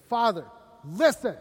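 loud, curt demand to his father; a resentful son talking down to his father; mean son. Retold or imitated. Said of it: "he starts to get mean".

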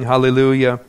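ordinary talk, nothing marked

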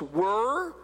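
"were" singled out with rising tune; "were" alone, lifted at the end; stress on were. Shown as a demonstration. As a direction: hold up "were" for notice. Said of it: "Notice the past tense".

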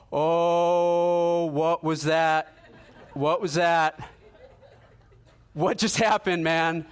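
high, loud astonished voice, slowing on what was that; retelling a friend who can't believe what he felt; imitating a man shaken by wonder; stunned amazement. Friend stunned after being prayed over.

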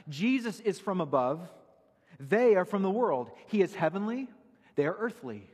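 impassioned pulpit contrast; rising preaching. Acted. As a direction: hammer each half of the contrast.

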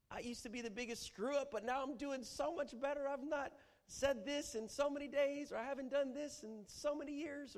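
very high, boastful whine; self-righteous appeal. Acted. Preacher acting a self-righteous prayer. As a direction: brag about your progress to God. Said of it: "appeal to the righteousness of your own self".

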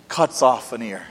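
ordinary delivery

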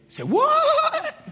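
high, loud, shrieking "what?"; a squeal of total disbelief; mock astonished yelp; shocked shout. Joseph's shocked reaction retold.

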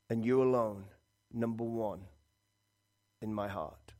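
hushed, low prayer with long pauses; repentant prayer. Acted. Preacher voicing repentance to God, hushed prayer. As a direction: pray softly and low, letting phrases fade.

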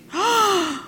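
high, soft shocked gasp; acted gasp. Storyteller reacts to the missing money, shocked gasp. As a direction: suck in air in mock alarm.

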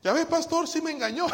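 high voice, half laughing; high mock whine. Husband whining to the pastor in a high laughing voice.

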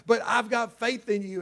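a hint of a coach rallying his team; coach's pep talk. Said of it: "the coach giving the pep talk".

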